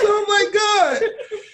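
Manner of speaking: high, loud laughing disbelief; oh my God disbelief. Retold or imitated. Son reacting to mama's excuse, high disbelief. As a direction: gasp it in disbelief.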